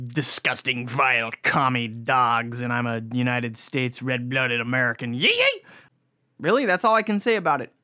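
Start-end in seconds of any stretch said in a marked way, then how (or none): 0.1-5.6 mock patriot. Mock patriotic anti-commie rant.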